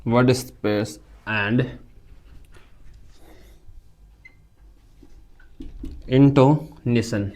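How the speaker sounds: words stretched far apart for writing down; very slow dictation pace. Shown as a demonstration. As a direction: dictate it slowly, word by word. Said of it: "लिख लो भाई".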